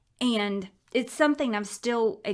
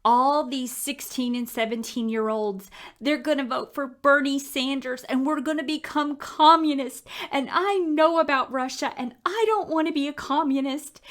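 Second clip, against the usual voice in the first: put-on higher and louder, a worried child pleading; upset son; 5 semitones higher, 4 dB louder than usual. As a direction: voice her upset ten-year-old son. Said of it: "he says".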